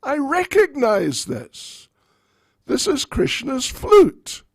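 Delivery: holds up the evidence in triumph; triumphant old Jatila. Acted. Jatila finds Krishna's flute on the table, high and accusing. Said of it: "looked at the flute and said".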